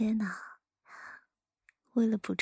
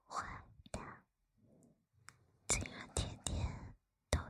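very soft close whisper; right in your ear; 11 dB quieter than usual. Acted. Teasing the listener up close.

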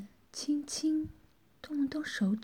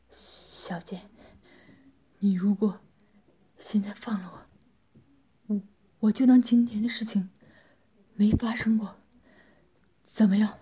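panting, halting plea in short bursts; out of breath; 2 semitones lower than usual. A narrator's reading. Worn-down captive begging the woman to release him, panting. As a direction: gasp out the bargain, stammering between breaths. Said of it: "气喘吁吁地说道".

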